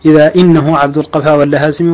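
usual speaking voice

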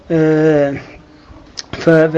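ordinary talk, nothing marked

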